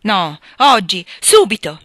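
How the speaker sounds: loud, drawn-out insistent demand; insist, beside yourself with agitation; forceful and slow, each word driven home; frantic insistence. A narrator's reading. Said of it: "insistette. Era talmente eccitato e fuori di sé".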